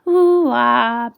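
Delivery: ordinary delivery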